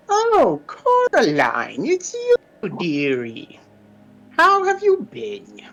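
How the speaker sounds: high, sing-song crone offering potions; old witch voice. Acted. Witch at her cauldron calls to the visitor in a high crooning voice. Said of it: "looks up and goes".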